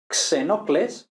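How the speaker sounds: xenocles with KS, slowly. Shown as a demonstration. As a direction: sound the X as K S. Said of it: "por ejemplo".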